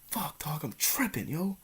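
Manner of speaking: soft, half-whispered fear; hushed panic. Retold or imitated. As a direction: keep it down, scared you're tripping. Panicking quietly while high.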